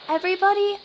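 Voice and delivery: host shouting to settle a noisy room; calling out. Real, not acted. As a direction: raise your voice over the crowd. Calling over chattering ghosts.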